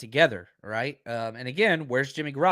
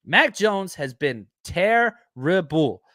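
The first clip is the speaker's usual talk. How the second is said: Charles Barkley impression; much slower than usual. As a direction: stretch every word like Barkley piling on. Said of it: "my, uh, Charles Barkley impersonation".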